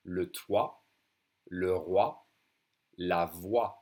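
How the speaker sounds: "toit", "roi", "voix" all ending in wa; silent final consonants. Shown as a demonstration. Teacher drilling O I words, each ending in an open wa. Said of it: "And the X silent, just like the T".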